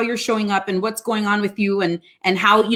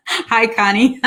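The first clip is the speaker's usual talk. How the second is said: laughing hello; 3 semitones higher, slower than usual. Real, not acted. Greeting her sister live.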